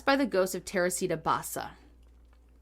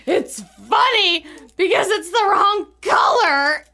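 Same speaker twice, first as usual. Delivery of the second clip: slow, loud, laughing half-awake line; laughing in sleep; 7 semitones higher, 7 dB louder, slower than usual. Retold or imitated. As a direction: giggle it out like a sleepy maniac, loud. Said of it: "And I was like".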